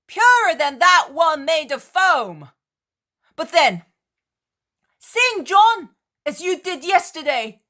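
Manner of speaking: high, very loud triumphant shout; loud defiant challenge. Acted. Peter shouting defiance at the Cretan in the storm. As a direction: taunt him boldly over the roaring sea.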